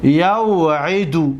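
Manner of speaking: یوعیدو said very slowly, each syllable stretched; slow original form. Shown as a demonstration. Teacher showing the original form, slowly drawn out. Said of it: "در اصل چی بود".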